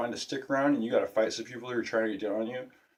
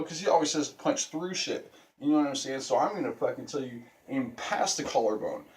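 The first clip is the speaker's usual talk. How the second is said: ordinary talk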